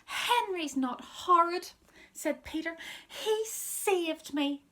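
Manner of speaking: put-on high, slow little-kid plea; earnest defence. Peter tells Mum Henry saved him, high earnest child voice. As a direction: defend him wide-eyed.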